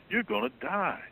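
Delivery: sudden high-pitched warning cry; voicing Paul's stern warning. Preacher voicing Paul warning the sinning Corinthians, high alarmed warning. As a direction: raise the pitch sharply to warn of death.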